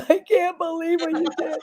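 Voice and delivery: high, tearful gush through laughter; hammed-up weepy disbelief; crying. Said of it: "I was crying".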